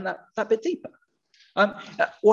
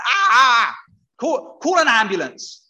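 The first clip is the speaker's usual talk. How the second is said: mock pained cry; 4 semitones higher, 5 dB louder, slower than usual. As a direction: pretend yelp of pain, then an urgent call. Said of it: "I've stubbed my toe, and it's really, really painful".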